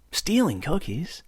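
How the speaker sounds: ordinary delivery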